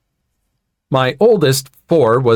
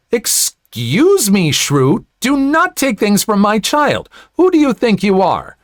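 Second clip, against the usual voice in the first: indignant confrontation; 9 semitones higher than usual. A narrator's reading. Mother to woman snatching son's sled, indignant. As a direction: tell her off, pitch leaping up. Narrator's acted angry confrontation.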